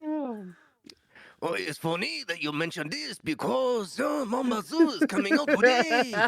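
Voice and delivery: loud, very high-pitched cartoon character. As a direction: announce it excitedly in a squeaky put-on voice. Performs a game character voice.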